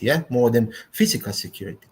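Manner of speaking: normal talking voice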